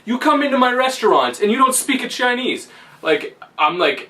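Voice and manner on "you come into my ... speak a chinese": mock scolding waiter. Angry waiter scolding the dreamer.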